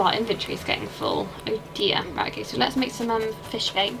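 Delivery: ordinary talk, nothing marked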